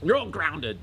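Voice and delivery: toned-down parental shout; mild shout. Acted. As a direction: restate the punishment with a milder yell. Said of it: "He just shouts at them".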